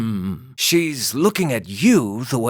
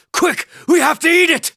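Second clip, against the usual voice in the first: excited urgent; 14 semitones higher, 4 dB louder than usual. Acted. Companion urges eating something.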